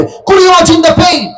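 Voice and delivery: high, slow, stretched exclamation; anguished preaching cry. Real, not acted. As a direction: cry it out high, appalled at the torment. Preacher cries out over torment.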